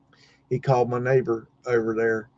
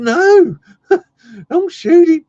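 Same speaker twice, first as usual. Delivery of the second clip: high, loud mock-panicked plea; imitating a startled cry to stop him; alarmed protest; 10 dB louder, slower than usual. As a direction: blurt it out high, laughing in the middle. Retold alarmed plea to spare dog.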